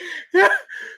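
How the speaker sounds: squealing laugh, way up high. Real, not acted. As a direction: break into a helpless high laugh. Laughing at the donor's message.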